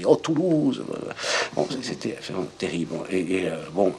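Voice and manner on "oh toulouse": mock Toulouse accent. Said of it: "avec l'accent de Toulouse".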